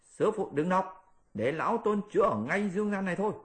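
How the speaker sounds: plead, then rush the promise; high pleading Monkey King. Acted.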